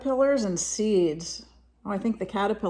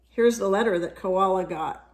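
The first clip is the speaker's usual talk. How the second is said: plain reading voice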